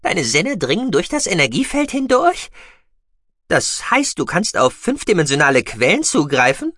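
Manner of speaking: high, bright creature voice; squeaky mouse-beaver voice. Acted. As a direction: play the excitable mouse-beaver. Gucki voiced by narrator.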